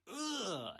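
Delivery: high, exaggerated groan of disgust; disgusted "ugh". Ranter acts out overreaction to pineapple pizza, mock disgust. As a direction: groan as if it were revolting.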